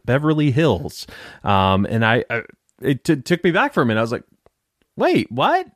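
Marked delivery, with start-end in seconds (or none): 5.0-5.7 high, rising double take; startled disbelief. Retold or imitated. Host reacting to the movie choice, startled. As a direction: shoot the pitch up in confusion.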